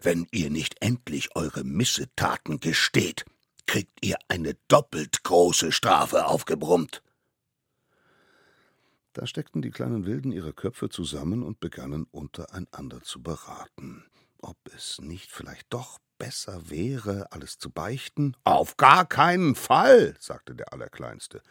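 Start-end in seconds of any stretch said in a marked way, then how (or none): 0.0-7.0 loud, weighty threat, slowing down; judge losing patience. Acted. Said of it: "platzte allmählich der Kragen". 18.4-20.1 put-on loud, high, drawn-out child protest; little one refusing at full voice; emphatic refusal. Smallest one flatly refuses.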